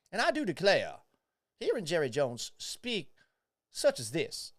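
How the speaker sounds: hammed-up Southern gentleman accent, I do declare. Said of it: "dabble in a bit of a Southern accent".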